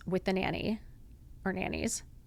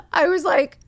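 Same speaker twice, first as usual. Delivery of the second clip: frozen shock face; 9 semitones higher, 9 dB louder than usual. Real, not acted. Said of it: "that's what I look like".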